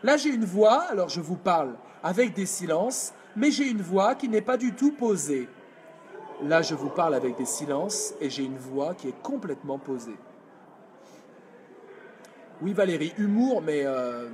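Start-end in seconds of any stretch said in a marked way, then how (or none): 0.0-5.5 unplaced voice. Shown as a demonstration. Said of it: "Je vous fais les deux voix". 6.4-10.2 lower, grounded and steady; calm anchored voice; placed voice. Shown as a demonstration. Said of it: "Je vous fais les deux voix".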